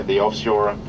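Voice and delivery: usual speaking voice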